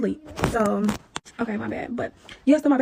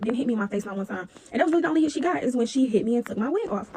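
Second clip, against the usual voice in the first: normal talking voice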